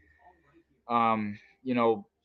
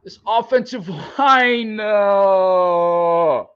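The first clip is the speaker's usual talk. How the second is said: high, slow, drawn-out lament; real fan wail; despairing wail; 9 semitones higher, 4 dB louder, much slower than usual. Real, not acted. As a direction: wail it like the team just broke your heart.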